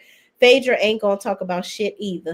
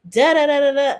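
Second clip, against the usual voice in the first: mocking da da da; 5 semitones higher, 5 dB louder than usual. Mocking Britt going on.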